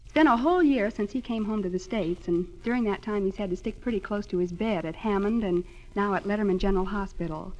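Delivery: ordinary talk, nothing marked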